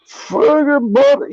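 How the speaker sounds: put-on high, slow drunken yell. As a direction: bellow it like a sloppy drunk. Drunk racist yelling on the bus.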